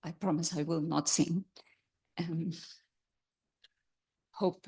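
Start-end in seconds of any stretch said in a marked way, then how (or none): none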